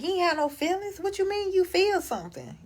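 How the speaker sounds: high, incredulous parent voice; mock disbelief. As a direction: scoff like a parent who finds feelings absurd. Mocking parents dismissing feelings.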